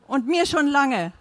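wife answering back in defiance; defiant retort. A narrator's reading. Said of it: "Monas trotzige Antwort".

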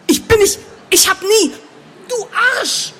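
high, loud, broken-off protest; explode, cutting yourself off, then spit out the insult; indignant outburst. Acted. Grona goaded by Lomir about waiting for her man, indignant outburst.

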